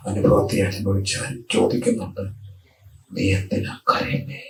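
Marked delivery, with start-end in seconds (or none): 3.2-4.4 hushed question. Acted. Christ asks the weeping woman.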